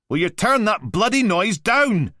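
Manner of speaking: loud, high furious demand; angry yell. Acted. Blakey yelling at the Mantis to turn the noise down, furious. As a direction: bellow across the office.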